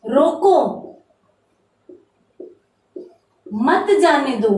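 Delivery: रोको alone, long pause, then मत जाने दो; pause after रोको. Shown as a demonstration. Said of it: "अर्थ बदल गया ना विराम चिन्ह के प्रयोग से".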